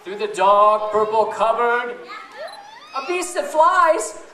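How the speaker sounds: lively, sing-song storybook reading; reading to children. Acted. Mother reading a beastie story to her children, sing-song and lively. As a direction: read it big and playful for the kids. Reads picture book aloud to kids.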